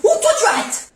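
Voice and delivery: high, sharp parental outburst; exasperated high-pitched scolding; angry warning. Acted. Mother cuts off cheeky son.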